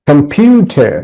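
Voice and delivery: Computer with "pyu" stressed; syllable stress. Shown as a demonstration. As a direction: lean on the middle "pyu" of computer. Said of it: "একটা করে হাততালি দিবে".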